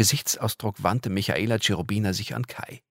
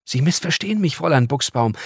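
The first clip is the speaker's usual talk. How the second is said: a higher, gentle pleading angel; 7 semitones higher, 6 dB louder than usual. Acted. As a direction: beg politely, soothing her. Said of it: "einen bittenden Gesichtsausdruck".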